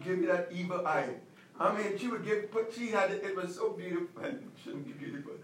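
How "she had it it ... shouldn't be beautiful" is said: ordinary talk, nothing marked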